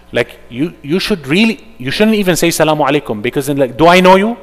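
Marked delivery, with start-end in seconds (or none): 3.8-4.4 high, loud suspicious question; hostile retort. Retold or imitated. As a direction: challenge the greeting coldly. Voicing a hostile stranger.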